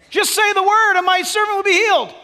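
high, loud cry of faith; acted centurion's shouted plea; fervent plea. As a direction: call it out boldly and urgently. Acted centurion's plea overheard by Jesus.

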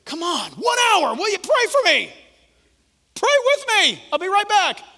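high, loud urgent plea; pleading Jesus. Acted. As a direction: press the sleepy disciples to pray.

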